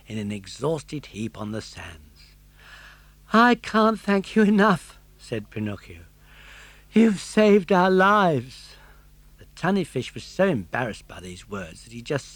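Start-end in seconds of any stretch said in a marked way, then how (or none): 3.3-5.0 put-on high, bright puppet boy voice; a grateful young puppet, pitched well up; Pinocchio's voice. Pinocchio thanking the tunny fish. 6.9-8.8 put-on high, slow, heartfelt puppet boy; Pinocchio's voice. Pinocchio thanking the tunny fish.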